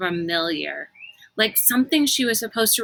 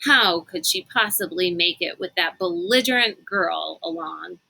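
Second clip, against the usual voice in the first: ordinary delivery, nothing marked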